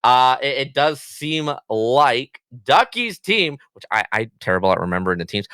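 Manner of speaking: ordinary talk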